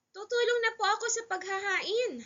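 put-on high, loud eager child; volunteer keenly in a high boyish voice; young boy. Son offering to help serve the meal, childlike.

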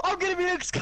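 high-pitched gleeful outburst; giddy, high and breaking into laughter; excited squeal. Real, not acted. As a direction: squeal it with delight. Gets to be Luke.

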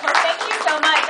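usual speaking voice

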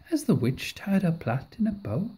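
ordinary delivery, nothing marked

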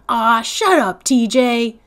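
loud, sharp angry retort; snapping. Acted. Stacy telling T J off, angry snap. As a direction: cut him off hard. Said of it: "Stacy snapped".

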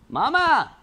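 loud high call, voice carrying through the house. Real, not acted. Calling mum to come see the swarm, loud high shout.

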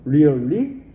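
loud rising Really; mock surprise. Shown as a demonstration. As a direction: act out being surprised for the class. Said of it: "model surprise expression". (놀란 척 크게 올려 말하기)

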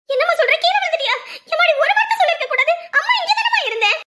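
high, loud, hammed-up alarm; overacted worried mother, gushing; fake motherly concern. Stepmother fakes worry for husband, loud and gushing. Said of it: "எங்க அம்மா Oscar லெவலுக்கு நடிக்குது".

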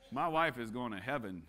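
usual speaking voice

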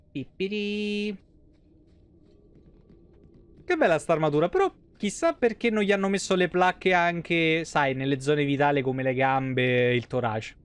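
usual speaking voice

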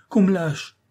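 high, loud cry for release; captive's urgent outcry; desperate pleading. A narrator's reading. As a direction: beg loudly to be let go. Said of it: "supplia-t-il".

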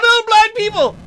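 high loud startled cry; startled yelp. Real, not acted. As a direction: yelp it, caught out. Startled by blind people.

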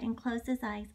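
usual speaking voice

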